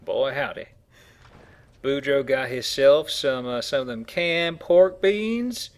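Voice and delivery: slow, loud Southern hillbilly drawl; hillbilly accent. Pretend. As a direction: play a backwoods Southern good ol' boy. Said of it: "pretty fucking hillbilly name".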